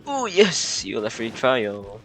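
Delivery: ordinary talk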